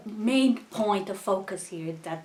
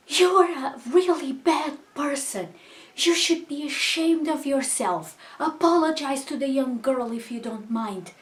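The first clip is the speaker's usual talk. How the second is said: wag a finger and shame her; scolding; 6 semitones higher, 6 dB louder than usual. Retold or imitated. Woman behind scolds the old lady for her insult, indignant.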